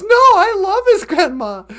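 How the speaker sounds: mock fan's indignant outburst; Nancy. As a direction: protest loudly through a laugh. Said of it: "Nancy goes".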